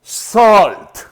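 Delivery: "Salt" drawn out with an O-like vowel; correct salt pronunciation. Shown as a demonstration. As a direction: model "Salt" slowly as if spelt with O. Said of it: "La pronunciación correcta es". ("Salt" pronunciado con O, alargado)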